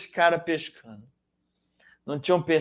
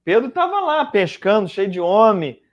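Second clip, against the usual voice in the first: usual speaking voice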